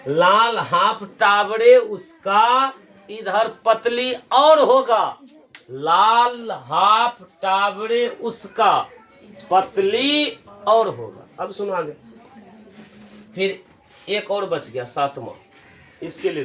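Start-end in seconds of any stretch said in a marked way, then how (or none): 0.1-11.4 slow, deliberate mnemonic chant; लाल हाफ Taware said slowly, word by word; slow drawn-out recital. Shown as a demonstration. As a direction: stretch every word so students remember it. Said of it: "देखो".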